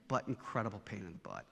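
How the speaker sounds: dropped low and soft, half under the breath; muttered aside. Real, not acted. Father to the audience about his son, quiet aside. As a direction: toss it off quietly like a private confession.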